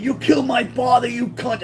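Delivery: high-pitched retold yell; acted-out scream. Son accusing the woman as he hits her, mock scream. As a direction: imitate screaming at high pitch without full volume. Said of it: "I'm screaming".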